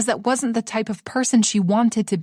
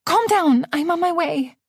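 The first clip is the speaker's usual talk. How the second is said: reassuring friend; 8 semitones higher than usual. Acted. Ruby reassuring on phone.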